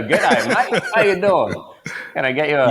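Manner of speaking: mock commercial Aussie accent. Imitating Outback Steakhouse commercials in a hammy Australian accent. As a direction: play the cheesy Australian ad guy. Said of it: "They just go".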